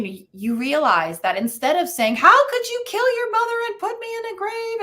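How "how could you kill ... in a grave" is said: mock horrified mom. Mom's hypothetical shocked reaction.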